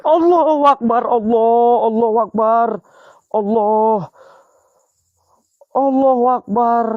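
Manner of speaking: high, loud frightened exclamations; panicked prayer. Real, not acted. Ghost hunter still shaken after the scare, loud frightened prayers. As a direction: blurt prayers in alarm.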